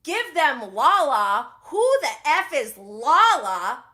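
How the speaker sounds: loud, slow, incredulous mock quote; imitating Schwartz. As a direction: throw it back scornfully, drawing out the name. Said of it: "Schwartz, who goes".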